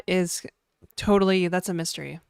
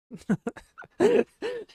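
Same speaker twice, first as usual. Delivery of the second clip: high giggle. Real, not acted. High laugh at own joke.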